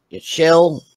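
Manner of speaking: ordinary talk, nothing marked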